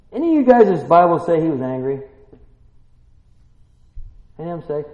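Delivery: ordinary talk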